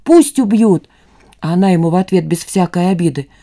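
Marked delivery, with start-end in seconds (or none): 0.0-0.9 raised, defiant yell, higher pitch. A narrator's reading. Son defying his mother's warning, yelling. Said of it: "орёт Костик".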